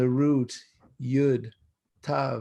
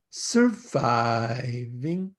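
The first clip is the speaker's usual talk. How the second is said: slow drawn-out surviving with a lift at the end; three-syllable sing-song; much slower than usual. Retold or imitated. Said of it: "in three sing-song syllables".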